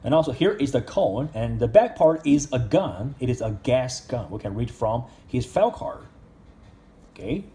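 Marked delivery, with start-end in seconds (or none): none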